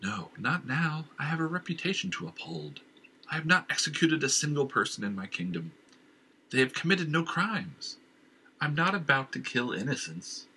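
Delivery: a king dismissing his inner voice, rising in pitch; hint of a commanding king; lightly voiced stern king. King refuses the voice.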